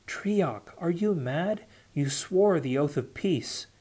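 high, urgent scolding cry; shouted rebuke. A narrator's reading. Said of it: "Atiaran shouted again".

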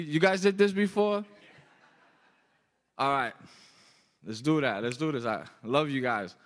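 normal talking voice

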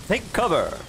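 a hint of game announcer; announcer imitation. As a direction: mimic an announcer's unconcerned warning. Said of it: "like an announcer announcing it. It didn't sound like he was in danger".

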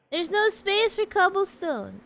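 loud, high-pitched complaint; exasperated exclamation. Real, not acted. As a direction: cry out at running out of room. Complains about full storage in game.

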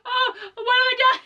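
high, squeaky mock horror; hammed-up panic. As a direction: shriek it out like a horrified monster. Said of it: "it was hilarious 'cause he's just like".

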